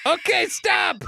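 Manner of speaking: high-pitched exclaimed plea to stop; calling a halt. Real, not acted. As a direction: cry out high to halt the uproar. Cutting off the laughing fit.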